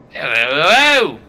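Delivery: loud, swooping hello; loud mock-friendly greeting; hearty in-character greeting. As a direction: call out a drawn-out hello. Farmers greet newcomers in unison.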